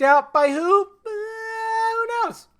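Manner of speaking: high, sing-song coy question; feigned ignorance. Pretend. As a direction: play innocent in a climbing high pitch. Feigns ignorance about the leaker.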